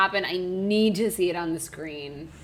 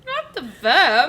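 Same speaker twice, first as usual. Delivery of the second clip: mock wail; 7 semitones higher, 4 dB louder, slower than usual. Mock lament over the worm.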